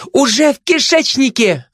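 very high-pitched triumphant cry. A narrator's reading. Glutton fends off the gossip after swallowing, crying out. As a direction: call it out high over the attacker. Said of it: "кричала обжора".

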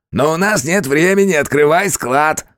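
high, nasty distorted thug. A narrator's reading. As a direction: sneer it in a twisted voice. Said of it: "противным искажённым голосом".

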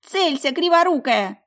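high, loud, slow granny yell; excited shout. A narrator's reading. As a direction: shout with reckless glee. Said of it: "с азартом кричала".

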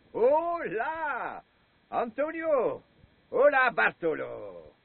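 high, loud, drawn-out hails; calling out. Acted. Drunk old man hailing two friends from afar, loud call.

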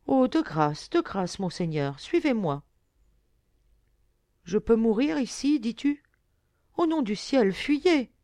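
high, urgent entreaty; urgent pleading. A narrator's reading. Fisher girl begging young lord to flee the tide, pleading. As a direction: beg him to get away at once.

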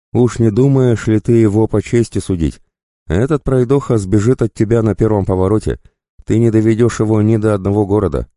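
high, taunting bandit voice; goading bandit. Acted.

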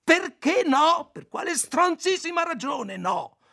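very high, loud and slow; furious shout. A narrator's reading. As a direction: scream the question at him. Ayla explodes at Roby.

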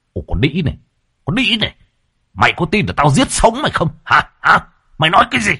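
savage threatening snarl; enraged husband. A narrator's reading. Husband pulls his wife's hair and threatens her, furious. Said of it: "điên tiết".

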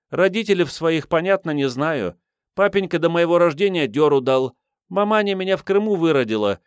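put-on high, lively girlish voice; cheeky waif shrugging off doubts; brazen street girl. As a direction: rattle off your life story in a high pitch. Voices the street girl's life story.

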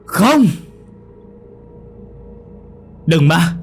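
high, loud cry of despair; anguished scream. A narrator's reading. Young man screaming as the ghost stabs, anguished yell. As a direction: scream it as he watches her stabbed. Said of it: "Cậu gào lớn".

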